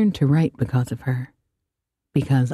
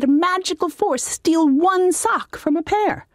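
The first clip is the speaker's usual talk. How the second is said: much higher, lofty scolding wizard; haughty wizard; 12 semitones higher than usual. Acted. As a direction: sneer down at the demon.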